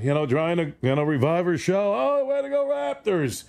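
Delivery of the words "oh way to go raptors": high, gushing mock Oprah cheer; lightly hammed-up gushing celebrity praise; imitating Oprah. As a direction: gush it like a talk-show host cheering the team. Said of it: "even Oprah".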